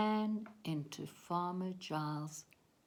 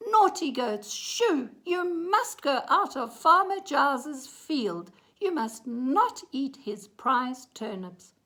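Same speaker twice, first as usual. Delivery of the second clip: loud, higher-pitched telling-off; scolding shout; 7 semitones higher, 10 dB louder than usual. A narrator's reading. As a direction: order the animals out firmly. Boy scolds and chases goats.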